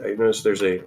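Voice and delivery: normal talking voice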